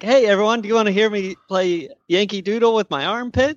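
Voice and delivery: put-on high, excited kid offer; lightly voiced Wakko bragging; eager Wakko pitch. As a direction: announce it like a proud kid performer. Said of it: "And he's like".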